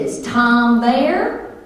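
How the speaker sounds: loud, high, long-drawn Southern twang. Acted. Wife ringing husband as her alter ego, Southern twang. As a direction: ask for him in a stretched-out Southern drawl. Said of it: "I called him as Shirley".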